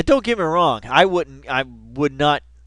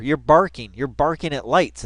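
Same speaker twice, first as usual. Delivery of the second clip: ordinary talk, nothing marked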